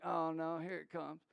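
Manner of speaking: low, quiet aside to self; dropped-voice inner groan; muttered dread. Retold or imitated. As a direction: brace for it under your breath. Retold inner dread at approaching critic.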